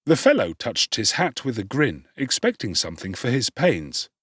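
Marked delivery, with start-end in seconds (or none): none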